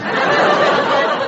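a very high squealing laugh; high shriek of laughter. Real, not acted.

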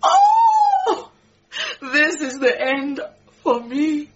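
high wailing oh then a sobbing this is the end; a hammed-up wail of despair; wailing lament. Maid to Ra, wailing over losing her job, mock sobbing.

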